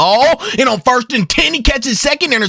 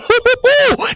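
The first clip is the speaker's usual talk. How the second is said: high, excited burst of laughter; 14 semitones higher than usual. Real, not acted. Player celebrating a big hit, high gleeful laugh.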